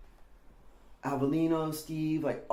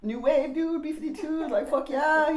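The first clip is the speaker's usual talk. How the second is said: much higher, louder scornful dude voice; mocking Randy; 14 semitones higher, 5 dB louder than usual. Older skater Randy scoffing at the boy's new wave tape, high loud sneer. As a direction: sneer at the music like a punk older kid.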